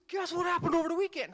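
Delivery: loud, high, fast blurt; excited student blurting. Retold or imitated. Student blurting gossip to classmates, excited. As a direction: burst out like a kid bursting with news.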